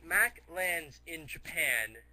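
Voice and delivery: ordinary talk, nothing marked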